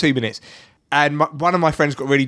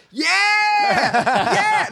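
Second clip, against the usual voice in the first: high, drawn-out whoop; imitated triumphant cheer; 14 semitones higher than usual. Drunk friend swimming breaststroke in the moat, whooping imitation. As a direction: mimic a drunken celebration. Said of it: "he's just going".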